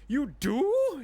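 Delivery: high, frantic shopkeeper voice, first words drawn out; a jittery shop lady begging you to stop; panicked shopkeeper. Acted. Shopkeeper startled.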